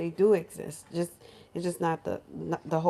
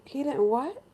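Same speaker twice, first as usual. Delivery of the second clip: stunned disbelief; 7 semitones higher than usual. Retold or imitated. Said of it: "my jaws dropped".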